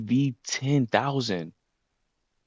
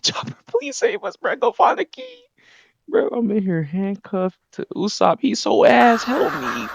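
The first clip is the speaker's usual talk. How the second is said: high mock begging; 6 dB louder than usual. Handcuffed pair begging Chopper.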